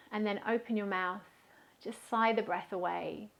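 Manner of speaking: guided meditation. Real, not acted. Yoga teacher guiding a festival class to settle, calm guided meditation. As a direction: guide them gently into stillness, leaving space between phrases.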